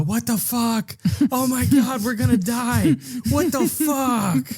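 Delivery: high, shrill panicked squeal, half sung; hammed-up terror, voice shooting way up; mock panic. Parents panicking in the bathroom over the photos. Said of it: "these parents do and go".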